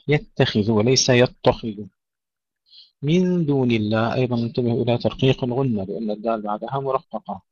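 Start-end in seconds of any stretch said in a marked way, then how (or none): none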